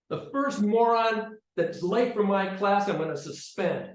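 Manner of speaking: stern threat. Retold or imitated. Teacher warns the sixth graders about being late, stern loud threat.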